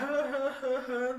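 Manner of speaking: short breathy laugh; brief laugh. Real, not acted. Laughs while mocking.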